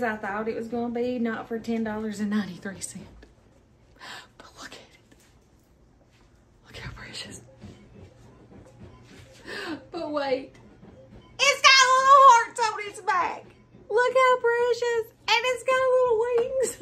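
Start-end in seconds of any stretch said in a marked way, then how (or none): 4.4-7.4 very soft, breath-only whisper; awed whisper. Real, not acted. Vlogger adoring her new plush, awed whisper. Hushed awe at a cute plush. 11.4-16.8 high-pitched excited cooing; gushing delight. Real, not acted. Vlogger discovering details on her plush, gushing delight.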